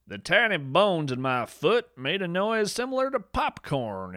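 ordinary delivery

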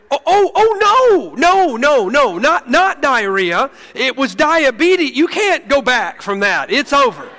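high, loud, slow dismay trailing into resignation; mortified pastor's dismay; horrified realization. Retold or imitated. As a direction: panic as it dawns on you, then give up. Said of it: "And I went".

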